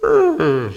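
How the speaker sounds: high, bored mock grunt of indifference; dismissive ugh. Preacher mimicking an apathetic friend, a high mock ugh. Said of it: "And they're like".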